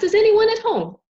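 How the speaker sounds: high, loud call through the door. Acted. Butterfly knocking a third time, calling out high and loud. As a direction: call out loudly so someone inside hears. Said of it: "this time quite loudly".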